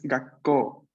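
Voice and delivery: gakko muy lento, con pausa antes de ko; consonante doble. Shown as a demonstration. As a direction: corta tras ga y suelta ko larga. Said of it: "pequeño tsu está antes de ko". (pronuncia gakko despacio, marcando la K doble)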